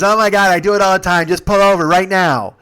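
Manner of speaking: high, loud, impatient whine of protest; imitated loud demand. Passenger ordering driver to pull over, loudly impatient. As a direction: demand it loudly, pitched right up.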